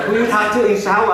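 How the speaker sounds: high, loud scolding mother; immigrant mom voice. Retold or imitated. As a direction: call out like a nosy mom. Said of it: "My mom's like".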